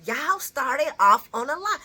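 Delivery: high, mocking comeback; sassy retort. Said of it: "And I'm like".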